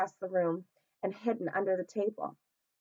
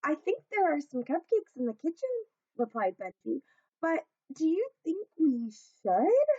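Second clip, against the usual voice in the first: higher, gentle uncertain voice; a timid small toy asking; 8 semitones higher than usual. Acted. Benji tells the hippo about cupcakes, high and unsure.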